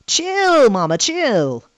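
imitated Southern drawl, first word pitched high; southern accent. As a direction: mimic a kid's Southern accent. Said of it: "talking in a southern accent".

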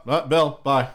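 ordinary talk

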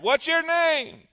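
the cave answering; echo. Acted. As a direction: echo the question back. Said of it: "He heard".

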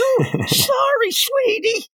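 very high, slow and sing-song; hammed-up sarcastic villainess; mocking sweet voice. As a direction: drip fake sweetness at him. Typhoid Mary answers Fisk mockingly.

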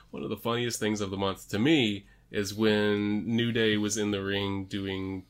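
normal talking voice

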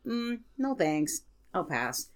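ordinary talk

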